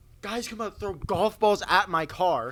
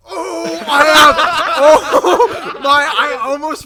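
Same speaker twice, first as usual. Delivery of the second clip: gasping, loud, high, laughing recall; excited gasp of remembering; sudden realization; 11 semitones higher, 17 dB louder, much slower than usual. Real, not acted. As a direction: burst out as the memory hits.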